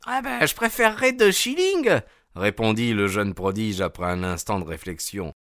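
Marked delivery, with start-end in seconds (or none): 0.1-2.0 put-on high child's voice, hesitant; a small boy thinking it over; little boy. A narrator's reading. (petit garçon, voix aiguë et hésitante)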